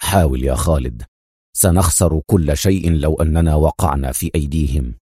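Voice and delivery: low, firm urging; urgent exhortation. A narrator's reading. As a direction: press him in a deep, insistent voice. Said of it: "صاح به أدهم".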